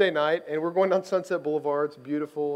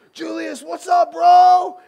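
high, building to a loud shout; boisterous greeting; 12 semitones higher, 12 dB louder, much slower than usual. Retold or imitated. Friend barging up to the player in a store, boisterous shout. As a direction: holler it like an overfamiliar fan. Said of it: "he's a little more boisterous than I am".

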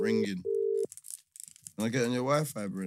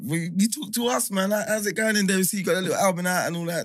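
eager, fast radio host hype; excited on-air greeting; 6 semitones higher, 6 dB louder, faster than usual. Real, not acted. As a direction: pump up the caller.

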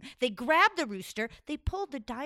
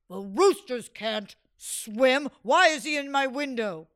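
louder scolding sultan; angry sultan; 8 dB louder than usual. Acted. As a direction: scold the servants.

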